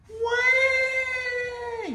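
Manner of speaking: put-on high wailing baby cry; baby whine. Imitating the villain throwing a fit, high baby wail. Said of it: "start whining like".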